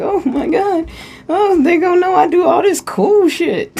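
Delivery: high, giddy mock of the shy host; mock bashful host. Channeler mocking the shy host, high and giddy. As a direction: squeal it like she's bashful about being cool. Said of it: "she's over here like".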